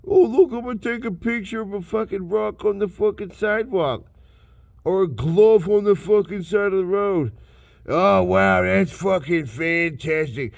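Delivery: high, sarcastic mock awe; mocking sarcasm. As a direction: sneer at the photo-takers with fake wonder. Mocking people who photograph junk.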